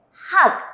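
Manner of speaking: slow "hak" ending on a crisp k; Korean syllable hak. Shown as a demonstration. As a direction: read "hak" carefully. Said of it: "voy a leer".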